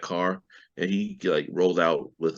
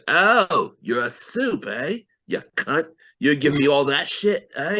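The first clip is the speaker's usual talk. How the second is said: put-on horrible Butcher accent; 7 semitones higher, slower than usual. Host mimics Butcher learning he's a supe, bad put-on accent. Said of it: "your accents are horrible".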